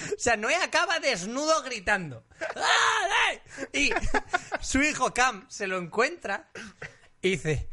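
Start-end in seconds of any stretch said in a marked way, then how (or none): 2.6-3.3 put-on drunk shout. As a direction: bellow it like a drunk old man. Noah naked shouting drunk.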